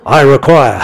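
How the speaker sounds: bold demand. Acted. Believer boldly demanding what's due, loud and firm. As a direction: stake your claim out loud. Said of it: "It means coming boldly".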